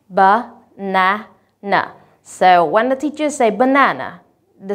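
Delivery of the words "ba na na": ba-na-na, slow and syllable by syllable; split into syllables. Shown as a demonstration. Teacher showing students syllables, slow and separated. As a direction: spell out each beat of ba-na-na slowly. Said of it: "three syllables".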